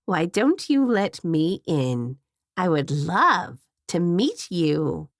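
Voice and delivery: low, slow coaxing grandma; sweet grandma voice. A narrator's reading. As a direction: coax them slowly like a sinister grandma. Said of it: "the voice said in Grandma's identical tone".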